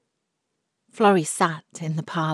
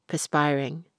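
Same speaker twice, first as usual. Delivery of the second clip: ordinary delivery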